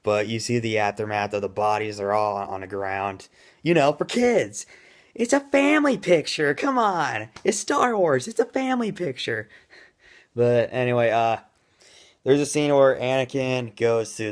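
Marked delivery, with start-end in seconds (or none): 3.6-9.5 high, mocking falsetto; sarcastic high voice. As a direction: sneer it up in a squeaky voice. Sarcastic mock defense of rating.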